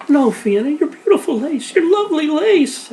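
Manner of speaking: very high, loud desperate cry; girl begging her sister to spare the lace, hysterical; hysterical scream. Acted. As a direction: wail as if the lace were lost forever. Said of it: "Alice screamed as she became hysterical".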